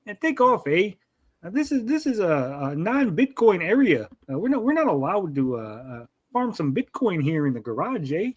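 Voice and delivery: sing-song cartoon Canadian; mock Canadian accent. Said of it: "That's Canadian sound".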